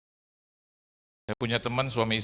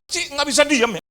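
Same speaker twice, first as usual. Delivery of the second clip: higher, louder stern rebuke; snapping at her; 11 semitones higher, 5 dB louder than usual. Retold or imitated. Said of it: "Akhirnya saya bentak". (membentak dengan tegas)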